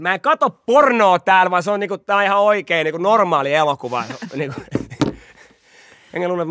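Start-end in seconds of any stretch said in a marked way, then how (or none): 0.0-4.0 loud shouted protest from a hospital bed; loud insistent shout; retold shout. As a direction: yell it out to the whole ward, half laughing. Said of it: "Sit mä oon huutaa".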